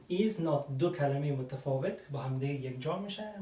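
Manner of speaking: usual speaking voice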